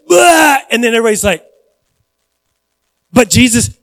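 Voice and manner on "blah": a sudden high mock blurt; mock loud outburst. Pastor mimicking someone scolding him in front of everybody, mock loud blurt. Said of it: "instead of just go".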